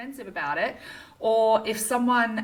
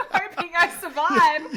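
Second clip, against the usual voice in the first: laughing; 9 semitones higher, 6 dB louder than usual. Real, not acted. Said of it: "choking on water".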